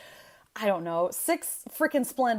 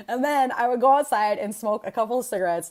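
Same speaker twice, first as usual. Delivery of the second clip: ordinary delivery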